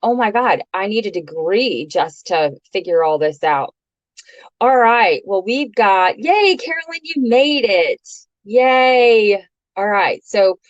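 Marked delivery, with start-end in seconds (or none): 6.2-9.5 high, bright cheer for a newcomer; cheering welcome. Real, not acted. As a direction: greet her like a cheerleader. Greets a new viewer joining.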